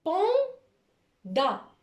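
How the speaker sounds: Panda in two drawn-out, separated syllables, high; split into syllables. Shown as a demonstration. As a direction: pause between pan and da. Teacher dictates a word by syllables.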